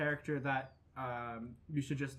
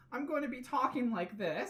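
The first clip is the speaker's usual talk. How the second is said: bright squeaky sing-song welcome; 8 semitones higher than usual. Acted. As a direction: pipe it up high and sing-song. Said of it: "that's my character voice".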